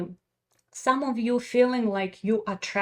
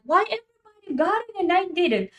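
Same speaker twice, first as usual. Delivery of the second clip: much higher mock-whiny voice; high pitched mocking whine; whiny mock complaint; 9 semitones higher than usual. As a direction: whine it like a jealous friend. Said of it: "And you're like".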